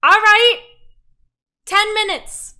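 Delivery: very loud, high holler; yelled reply. Acted. Woman in the back room answering the innkeeper, yelling. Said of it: "And she's like".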